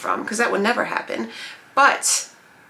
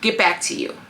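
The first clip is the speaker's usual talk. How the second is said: ordinary talk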